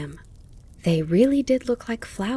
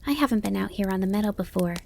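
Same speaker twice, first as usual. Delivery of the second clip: put-on quick, light child voice; Bambi; 3 semitones higher, faster than usual. A narrator's reading. Fawn telling the grasshopper he is new here, innocent. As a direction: confide quickly as a young fawn. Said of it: "Bambi told him".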